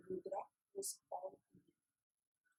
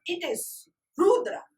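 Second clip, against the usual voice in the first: louder, slow, all together; loud crowd answer; 3 semitones higher, 11 dB louder than usual. A narrator's reading. Town folk answering the master in one voice, loud and slow. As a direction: town folk shouting their complaint, slow.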